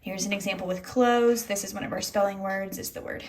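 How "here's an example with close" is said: normal talking voice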